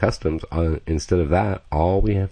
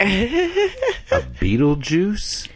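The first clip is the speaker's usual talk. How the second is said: laughing; much slower than usual. Real, not acted. Laughing while describing impersonators.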